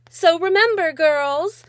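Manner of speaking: put-on high, loud presenter addressing the girls; rally the audience like a keynote speaker; upbeat speech voice. Mother addresses girls after speech.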